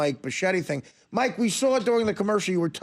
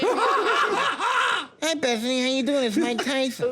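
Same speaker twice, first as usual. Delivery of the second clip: eager boxer inviting himself along; Mike Tyson. Acted. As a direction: play a famous boxer saying hello. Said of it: "Mike Tyson just walked in".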